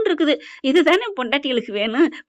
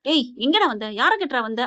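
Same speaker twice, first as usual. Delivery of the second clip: normal talking voice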